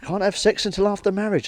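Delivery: lightly mocking high voice; mock repeat. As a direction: echo her words back as a squeaky parody. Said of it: "He said".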